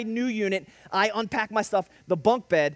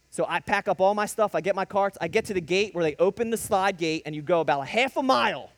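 ordinary talk, nothing marked

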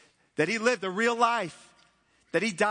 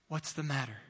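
quiet question; 6 semitones lower, 8 dB quieter than usual. Acted. Preacher asks congregation quietly.

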